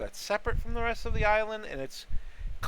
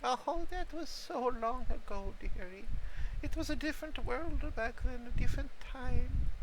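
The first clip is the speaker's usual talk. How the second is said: put-on old mushroom voice; 7 semitones higher than usual. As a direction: elderly voice. Elderly mushroom NPC reminisces.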